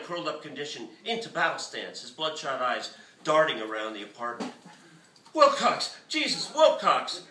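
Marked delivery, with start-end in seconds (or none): 5.3-7.2 high, loud frantic shout; hysterical shout. A narrator's reading. Artist shouting hysterically back into frozen phone. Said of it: "Griffin hysterically shouted back".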